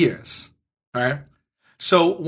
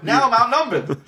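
laughing through the words; 6 semitones higher than usual. Real, not acted. Laughs while telling.

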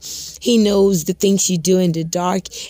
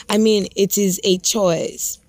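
usual speaking voice

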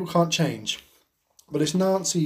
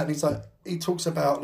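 ordinary delivery

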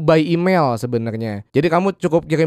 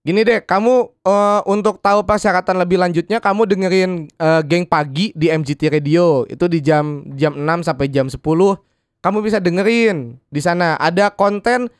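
ordinary delivery

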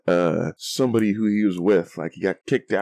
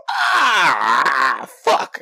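drawn-out mock yell, pitched up; imitated cry of pain; 5 semitones higher, 4 dB louder than usual. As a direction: imitate someone hit from behind crying out. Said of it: "I just hear him go".

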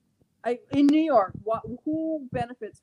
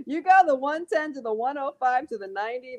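usual speaking voice

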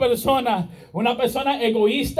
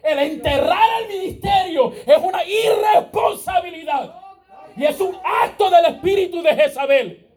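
fiery preaching; 4 semitones higher than usual. Acted. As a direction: preach it hard to the congregation. Preacher at full force.

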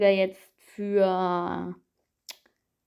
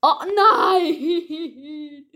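high, loud laughing wail; laughing despair; 7 semitones higher, 5 dB louder than usual. Real, not acted. Eliminated, laugh-cry.

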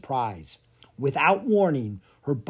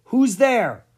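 high, loud challenge; startled call; 8 semitones higher, 8 dB louder than usual. A narrator's reading. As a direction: call out sharply to an unseen intruder. Startled man calls through door.

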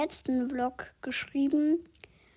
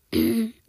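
clearing throat; 8 dB louder than usual. Real, not acted. Something stuck in her throat.

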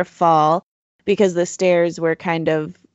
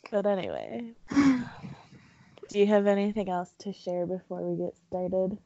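muffled behind a hand; covering the mic; 9 dB quieter than usual. Real, not acted. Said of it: "covering the mic a little bit".

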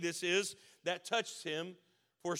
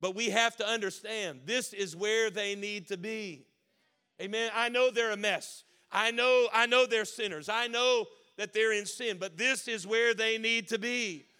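raised, emphatic preacher's voice; impassioned preaching; 3 semitones higher, 4 dB louder than usual. Real, not acted. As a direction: preach it with rising force to a congregation. Preaching at rising force.

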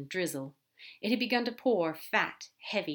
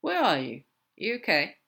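concerned check-in, pushed louder; 6 dB louder than usual. Acted. Crowley checking on Aziraphale over the phone, concerned. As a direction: ask with urgent concern. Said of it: "quickly shifts to a quieter concern".

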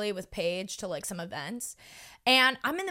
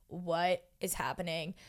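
low, slow, hushed disbelief; dumbfounded, deliberate and quiet; retold bewilderment; 6 semitones lower, 8 dB quieter than usual. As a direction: softly spell out the confusion. Retelling bewilderment at the envelope.

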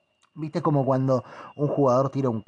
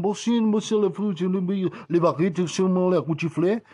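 lightly hammed-up French with a higher voice; mock French; 5 semitones higher than usual. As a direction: fake French gibberish, pitched up. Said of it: "me decían".

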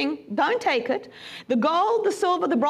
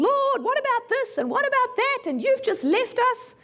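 higher whining list of grievances; complaining Joshua; 8 semitones higher than usual. Retold or imitated. Preacher acts Joshua griping to the Lord, higher and whining. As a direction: moan up at heaven like a sulking servant.

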